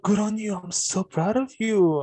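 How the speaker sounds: mock patronizing praise. Teacher praising the student she wrote off, patronizing.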